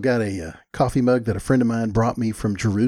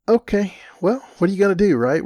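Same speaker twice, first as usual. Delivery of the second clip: resigned shrug; 9 semitones higher, 4 dB louder than usual. Real, not acted. Shrugging off botched reservation.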